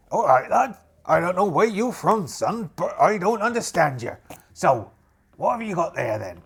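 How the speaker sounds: put-on captain's voice, probing; puzzled pirate captain. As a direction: size up the stranger as a captain.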